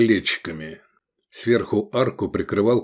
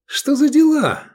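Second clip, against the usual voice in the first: high, loud indignant question; starting to seethe; 7 dB louder than usual. A narrator's reading. Kicked diner to his friend, seething. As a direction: boil up in protest. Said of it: "закипая".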